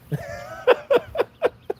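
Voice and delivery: high-pitched burst of laughter. Real, not acted. Storyteller after quoting the guy beside him, high laughing. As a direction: laugh in a high, stuttering run.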